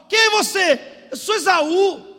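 high, shouted question and answer; emphatic replay. Retold or imitated. Preacher replays Isaac questioning Jacob, loud and high. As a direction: hammer out both lines as a preacher.

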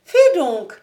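loud, high cry of reproach; sharp wifely rebuke; shocked exclamation. A narrator's reading. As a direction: exclaim in scandalised protest. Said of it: "s'écria mistress Girder".

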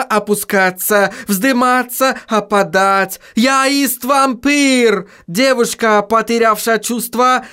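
put-on high, strained German-accented vampire. A narrator's reading. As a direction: explain it in torment, high. Tormented vampire explanation.

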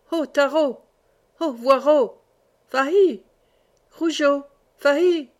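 calling the hounds. A narrator's reading. Huntsman calling his greyhounds in the manor courtyard, loud calls.

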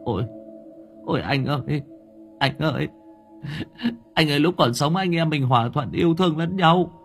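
frightened wailing plea; stammering plea. A narrator's reading. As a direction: beg the ghost in fear. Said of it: "Ông lắp bắp".

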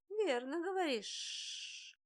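slow, high hissing serpent laugh. A narrator's reading. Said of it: "шипяще рассмеялся Чиу".

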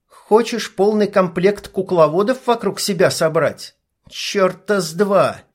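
high, heated blurting; angry outburst. A narrator's reading. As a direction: spit the words out in anger. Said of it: "выпалила Ника". (гневно выпалить)

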